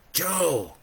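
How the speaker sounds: put-on high child calling a name; high, childlike call; little boy's voice. A narrator's reading. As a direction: call out as a small boy. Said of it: "that little boy's voice from long ago".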